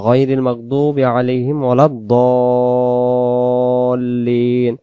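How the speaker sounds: slow chanted verse, দাল্লিন held very long as "Dooolleen"; heavy Dad in recitation. Shown as a demonstration. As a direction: recite it, stretching a rounded "Do" in দাল্লিন. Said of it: "সেখানে দদ অক্ষর দিয়ে".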